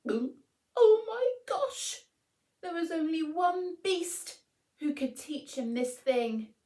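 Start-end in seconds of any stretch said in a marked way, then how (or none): 0.1-2.0 slow, higher and louder fretful aside; a storyteller's nervous gasp; worried gasp. A narrator's reading. Narrator fretting for the mouse, slow high gasp.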